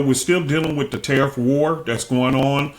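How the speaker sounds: usual speaking voice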